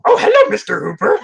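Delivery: very high, squeaky put-on greeting; rehearsed character voice. Co-host re-enacting solo rehearsal the neighbors overheard, high character voice. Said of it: "just me all of a sudden going".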